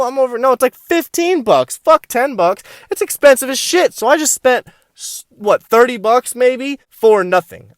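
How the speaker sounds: high-pitched mock-angry rant; hammed-up annoyance at wasted money; retold indignation. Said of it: "I'm pissed".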